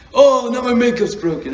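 mimicking her wail about makeup; mock whiny girlfriend. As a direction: whine like a dramatic girlfriend. Mocking girlfriend's complaint.

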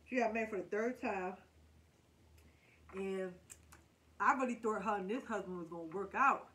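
speaking between bites; talking while chewing. Real, not acted. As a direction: mouth partly full of food. Seen 0: bites food bent over dish, then talks with chewing mouth.

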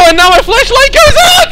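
very high, loud cry of dismay; high wail breaking into a scream; panicked wail. Real, not acted. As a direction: shriek it in panic. Flashlight fails in horror game.

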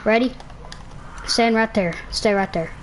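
ordinary talk

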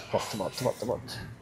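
low quiet coaxing; soft coaching. Real, not acted. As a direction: guide her back gently. Coaching slow return.

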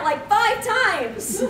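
ordinary delivery

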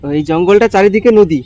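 loud question to a deaf man; speaking loudly. Real, not acted. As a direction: raise the voice so he hears. Talking loudly to a deaf old man. (জোরে প্রশ্ন)

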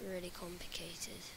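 hushed whisper aside; whispered aside to the viewers. Real, not acted. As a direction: drop to a breathy whisper for emphasis. Aside after saying it's complicated.

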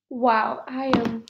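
ordinary talk, nothing marked

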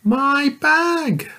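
put-on little girl answering the giant. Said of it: "said the little girl".

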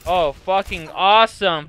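usual speaking voice

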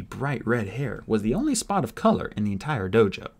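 plain reading voice

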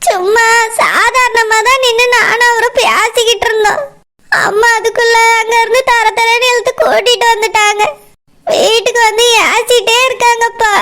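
tearful pleading with dad; crying. Acted. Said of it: "அழாத".

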